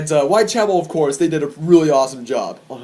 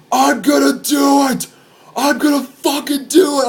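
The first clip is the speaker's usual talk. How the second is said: high, strained crowd-rousing shout; retold daredevil yell; 9 semitones higher, 4 dB louder than usual. Man on the second story to the crowd, wild yelling. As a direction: holler it like a reckless stage diver. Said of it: "everybody's freaking out".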